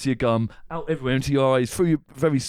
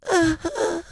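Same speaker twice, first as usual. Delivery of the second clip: tiny high whimpering; imitated high, stifled sobs; pathetic whimper. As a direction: whimper like a crying baby mouse. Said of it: "it's just a whimper".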